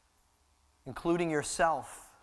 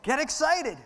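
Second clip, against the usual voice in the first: high, urged shout to the congregation; excited call; 9 semitones higher than usual. Shown as a demonstration. Preacher urging the church to get excited. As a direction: get them fired up. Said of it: "tell your neighbor".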